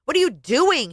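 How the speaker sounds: husband yelling at his crying wife; yelled. Acted. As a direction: throw the question at her. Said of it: "he yelled again".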